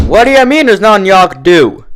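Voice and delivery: high, loud disbelief; indignant outrage. A narrator's reading. Applejack confronts the clerk, high and loud. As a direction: demand an answer, outraged.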